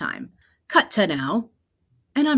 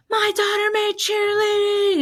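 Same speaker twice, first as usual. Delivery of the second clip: high, loud proud shout out the car window; proud mom yelling; 4 dB louder than usual. Retold or imitated. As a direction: yell it to the whole street like a proud mom. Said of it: "she screams and honks".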